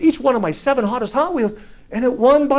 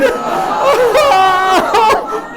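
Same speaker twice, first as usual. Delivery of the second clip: loud, high comic wail; mock anguished wail; 12 semitones higher, 5 dB louder than usual. Boy gets a dull toy car, hammed-up wail of dismay.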